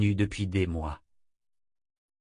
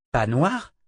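astonished. A narrator's reading. Scholar taken aback by his friend's correction, astonished. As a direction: echo it back in startled disbelief. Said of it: "s'étonna Warren".

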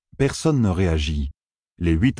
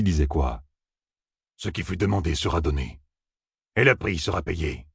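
cold otherworldly voices. A narrator's reading. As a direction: state the terms like ancient creatures. Fox-people speak coldly.

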